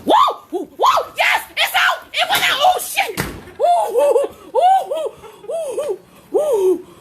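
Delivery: very high, frantic screams; panicked shrieking. Real, not acted. Woman shouting as the bird gets out, panicked shrieking. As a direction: shriek in bursts as it flies at you.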